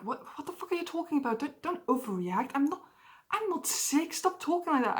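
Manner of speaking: high-pitched, mildly agitated protest; retold losing it. Retelling a protest at a friend's advice, agitated. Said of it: "I completely lost my shit".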